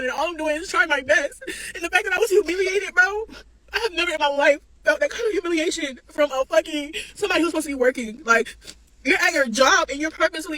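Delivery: fighting back tears while venting to viewers; crying. Real, not acted. Tearful venting to followers, voice breaking. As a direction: voice cracking and rising as she cries.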